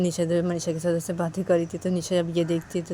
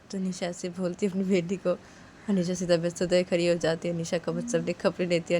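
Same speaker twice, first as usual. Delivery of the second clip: ordinary talk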